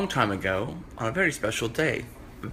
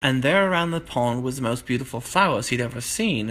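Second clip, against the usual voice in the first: ordinary delivery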